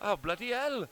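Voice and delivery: hammy Welsh lilt; Welsh accent. Pretend. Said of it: "It's so bad".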